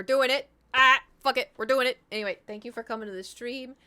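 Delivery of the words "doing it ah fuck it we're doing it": punchy, higher shouted resolve; loud self-psyching. Real, not acted. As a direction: shout yourself into it. Hyping herself to go through.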